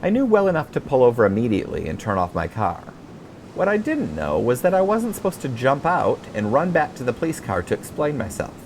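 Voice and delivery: plain reading voice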